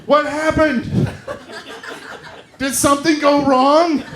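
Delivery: imitating gasping sister-in-law with mock worry; mock horrified concern. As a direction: throw the shock right back at her. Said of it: "I went".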